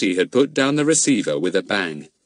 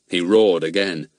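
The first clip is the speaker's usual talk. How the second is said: ordinary delivery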